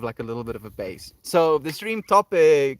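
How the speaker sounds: normal talking voice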